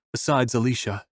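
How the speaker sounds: ordinary delivery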